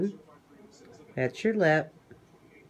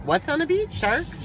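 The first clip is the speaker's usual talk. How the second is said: surprised; 12 semitones higher, 5 dB louder than usual. Real, not acted. Mock surprise at sharks.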